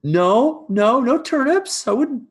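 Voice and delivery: high, loud startled stammer; flustered servant caught. Acted. Servant caught picking by the farmer, flustered. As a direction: stumble over denials, pitched well up. Caught on neighbor's land, in character.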